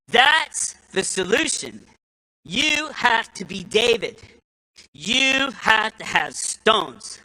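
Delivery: drawn out, weighty pronouncement; preacher driving home his point; slow, emphatic preaching. Real, not acted. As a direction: land each word slowly.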